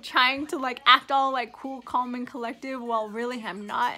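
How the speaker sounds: usual speaking voice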